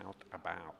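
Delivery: "Out about" with wide, pancake-flat OW; flat American vowels. Shown as a demonstration. Interviewer practising American after coach, flat "Out about". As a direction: say "Out about" flat, lips back. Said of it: "It's that flat pancake".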